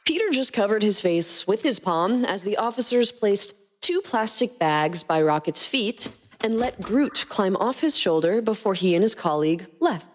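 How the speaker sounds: ordinary delivery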